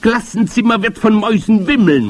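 high, loud witch proclamation; forceful high witch call. A narrator's reading. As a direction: cry it out to the whole hall. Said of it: "rief die Hoch".

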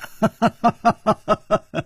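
string of even ha-ha laughs; carefree staged laughter; hearty laugh. A narrator's reading. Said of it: "楚留香笑了".

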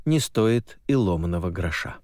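usual speaking voice

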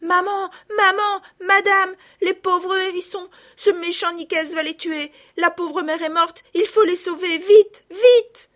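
high, loud breathless rush of words; frantic. A narrator's reading. Girls bursting in to their mother, frantic and loud. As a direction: blurt it all out in a panic.

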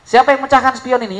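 angry father. Retold or imitated. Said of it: "Bapaknya tanya".

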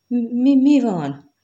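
high, loud panicked stammer; a dying son's frightened stammering cry. Acted. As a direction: blurt it out in rising terror.

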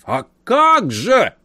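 high, slow exclamation; emphatic uncle. A narrator's reading. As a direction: exclaim it eagerly. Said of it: "подтвердил дядя".

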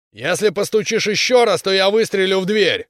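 high, loud angry warning; shouted threat. A narrator's reading. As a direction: bellow a threat through the door. Armed man threatens through door. (гневная угроза криком)

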